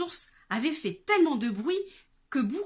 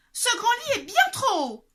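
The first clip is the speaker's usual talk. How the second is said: put-on high, loud girlish cry; girl's high loud exclamation; exclaiming girl; 13 semitones higher, 10 dB louder than usual. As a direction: complain loudly, up high. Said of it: "s'écria-t-elle".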